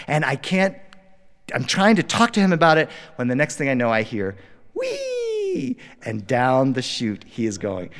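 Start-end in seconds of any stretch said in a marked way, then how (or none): 4.7-5.7 put-on high gleeful kid squeal; child's squeal. Toddler sledding away from dad, a high happy squeal. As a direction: squeal like a toddler flying downhill. Son sliding down the chute.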